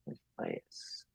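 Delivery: slow, barely audible whisper; whispered aside. Acted. Audience member as the play dies, slow whispered aside. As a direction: whisper it slowly, like a private thought.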